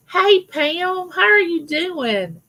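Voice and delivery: high, slow sing-song hello; delighted greeting. Real, not acted. Welcoming a viewer who just joined, high sing-song greeting.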